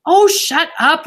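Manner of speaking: high, loud, drawn-out irritation; annoyed retort. Acted. Ramona silences her lecturing sister, annoyed. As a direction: cut off a nagging sister.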